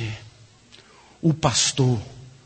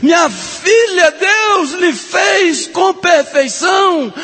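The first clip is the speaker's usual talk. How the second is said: slow, high, loud shouted blessing; preaching shout; 5 dB louder, much slower than usual. Acted. Preacher shouting God's love to the women, slow loud cry. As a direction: cry it out slowly to the daughter.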